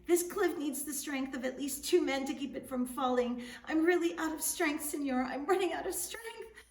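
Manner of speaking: high, loud, exhausted pleading; strained yell. Acted. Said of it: "Juan yelled".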